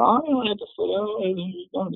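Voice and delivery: high, mock-angry drunken griping; imitated cussing. Drunk dad answering his pleading wife, mock cussing. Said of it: "he starts cussing at her".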